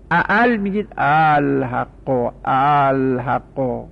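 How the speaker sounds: الحق drawn out slowly, hamza eased toward alef; softened hamza. Shown as a demonstration. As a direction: glide through the hamza of الحق. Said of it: "بخوای تسهیل تلفظ کنی".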